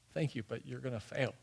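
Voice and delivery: very soft, sober letdown; hushed hard news. Retold or imitated. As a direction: let them down quietly. Voices Jesus breaking hard news.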